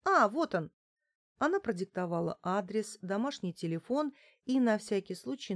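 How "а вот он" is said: higher, delighted little cry of finding it; happy "found it" burst; pleased exclamation. A narrator's reading. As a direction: exclaim with sudden glad relief. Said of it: "обрадованно воскликнула".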